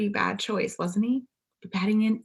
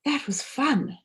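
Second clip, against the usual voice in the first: delighted; 8 semitones higher, slower than usual. A narrator's reading. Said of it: "exclaimed Paddington".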